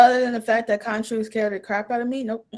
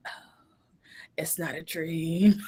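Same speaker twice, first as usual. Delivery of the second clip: whispering; 3 semitones lower, 4 dB quieter than usual. Retold or imitated. Steven waking, retold scene.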